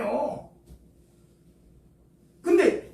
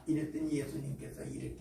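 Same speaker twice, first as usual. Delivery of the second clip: usual speaking voice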